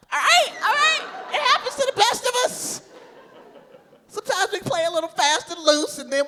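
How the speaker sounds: very high, loud comic protest; defensive. Acted. Comic owning up to an unplanned baby, very high and loud. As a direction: squeal it out defensively.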